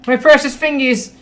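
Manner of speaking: pained shriek. Pretend. Losing fingers in horror game.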